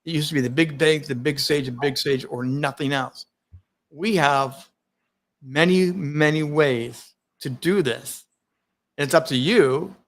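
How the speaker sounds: usual speaking voice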